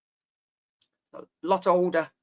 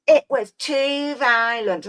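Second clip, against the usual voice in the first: mock disapproving voice; 5 semitones higher, slower than usual. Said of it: "oh, yeah".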